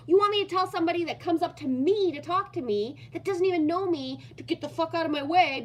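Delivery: high, loud, fast indignant outburst; retold angry rant. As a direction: fire it back at him, fed up. Retold outburst at her partner.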